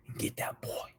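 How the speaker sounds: hushed vengeful whisper. Acted. Whispered threat.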